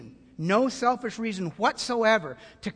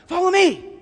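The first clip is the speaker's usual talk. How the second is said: rallying call; 10 semitones higher, 5 dB louder than usual. Pretend. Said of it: "when does somebody say such a thing".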